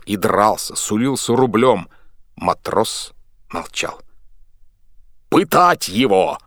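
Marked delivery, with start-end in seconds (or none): 5.3-6.4 loud, slowed bellow of a cruel order; slow yelled order. A narrator's reading. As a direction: roar it out slowly. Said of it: "орал боцман". (боцман орёт, требуя пытки)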